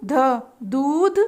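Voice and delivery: very slow syllable drill; teacher building words slowly; slow sounding out. Shown as a demonstration. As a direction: stretch each part for children. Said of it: "उच्चारित करने के लिए कहेंगे".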